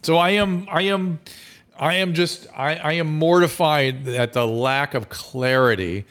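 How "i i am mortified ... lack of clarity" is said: ordinary delivery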